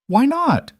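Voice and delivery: put-on high child voice, demanding. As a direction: insist like a little girl. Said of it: "Megan demanded".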